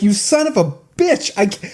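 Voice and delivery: high, exasperated cursing; worked-up, high-pitched ranting; retold angry outburst. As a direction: curse out the machine in comic outrage. Retold outburst at payment refusal.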